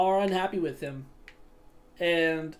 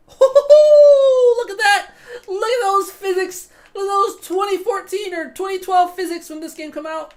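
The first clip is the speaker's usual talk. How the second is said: much higher and louder, laughing, shouting in delight; excited laughing shout; 8 semitones higher, 9 dB louder than usual. Real, not acted. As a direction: crack up and yell at the goofy physics. Laughing at old game physics.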